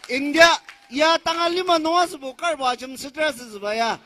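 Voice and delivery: ordinary delivery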